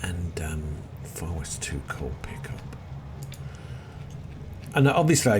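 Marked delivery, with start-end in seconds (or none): 0.0-2.6 whisper. Real, not acted.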